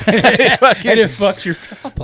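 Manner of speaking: slow words through laughter; laughing. Real, not acted. Both laughing at joke.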